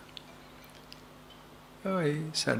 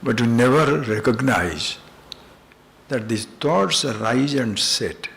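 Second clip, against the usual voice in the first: emphatic preaching; 3 semitones higher, 7 dB louder than usual. Real, not acted. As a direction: preach it with force. Emphatic teaching climax.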